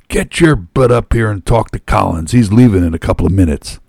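loud, high, rapid-fire scolding; bossy, hurried shout; yelling. A narrator's reading. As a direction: yell orders like an auctioneer. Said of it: "with hands on hips and yelled".

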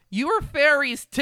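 hammed-up scolding cry; exasperated outburst. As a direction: throw up your hands in disbelief. Exasperated at cowering girls.

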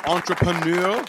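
put-on word said with a playful French accent. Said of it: "I try to put my little French accent on".

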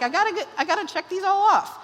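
high, fast mock-frantic voice; a pastor mocking a to-do-list mindset, high and hurried; mock frantic checklist. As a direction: play the anxious box-ticker, rushed and high. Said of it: "don't take it home and be like".

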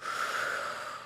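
long unvoiced exhale; deep sigh. Shown as a demonstration. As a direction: let out a big breathy sigh. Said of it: "I have to be like".

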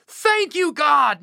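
overjoyed high thank you; joyful yell. Acted. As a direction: shout thanks to the sky. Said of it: "Naaman yelled".

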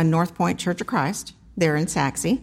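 normal talking voice

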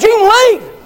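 high, loud ordering him out; mock angry dismissal. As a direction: throw him out of the church. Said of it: "And I said".

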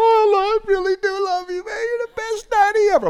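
very high, squeaky, mock-sobbing child voice; gushing little boy. Son crying into his dad's shoulder, squeaky high and gushing. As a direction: play the boy blubbering his love.

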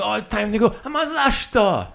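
high, loud, hammed-up protest; mock dismay. Guest at Turkish coffee told it's time, loud mock dismay. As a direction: climb into a loud mock wail.

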